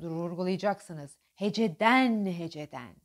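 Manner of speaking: ordinary talk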